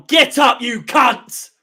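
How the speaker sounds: loud, high shout at the player to get up; angry yell. Real, not acted. Fan yelling at a downed player on the TV, angry. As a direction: bark it at the screen, fed up.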